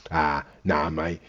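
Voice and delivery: low, very soft brush-off; understated, low-pitched quoted reply; Wesley Snipes. Retold or imitated. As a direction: imitate a casual, quiet refusal, voice dropped right down. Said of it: "Wesley Snipes is like".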